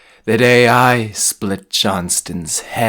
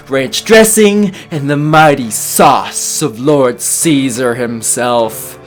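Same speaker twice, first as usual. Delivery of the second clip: higher, louder peak of a list; rising exultation; 4 semitones higher, 4 dB louder than usual. Acted. Sandwich maker listing his epic ingredients, exultant. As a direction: build to a triumphant ingredient.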